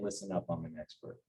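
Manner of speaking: implied softly. Shown as a demonstration. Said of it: "without saying".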